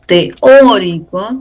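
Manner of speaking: "teórico" slowly with a strong Ó; stress on O. Shown as a demonstration. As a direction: stretch and hit the first O of "teórico". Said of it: "tilde en la O".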